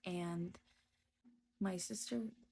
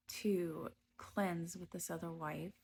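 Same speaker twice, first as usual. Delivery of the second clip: usual speaking voice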